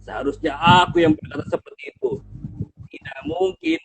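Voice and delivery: loud, scornful lion with a booming laugh; mocking lion. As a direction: laugh down at the tiny mouse. Singa tak percaya, menertawakan tikus. (singa mengejek tikus sambil tertawa keras)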